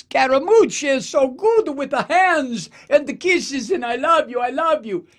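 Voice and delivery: high, loud, gushing "I love you"; hammed-up schmoozer blowing kisses; mock Scaramucci working the room, gushing; gushing Scaramucci impression.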